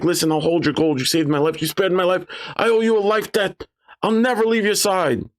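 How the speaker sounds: high-pitched goblin pledging loyalty; earnest goblin oath. Acted. Goblin swears loyalty to its rescuers.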